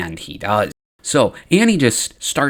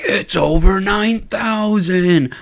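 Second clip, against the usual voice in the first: high, drawn-out exclamation; hammed-up amazement; 7 semitones higher, much slower than usual. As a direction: stretch it out in mock-epic astonishment. Obi-Wan exclaims at a huge count.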